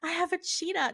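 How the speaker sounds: put-on high-pitched voice of a kid bursting with news; excited little boy. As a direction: blurt it like a thrilled young son telling mom. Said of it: "he was so excited".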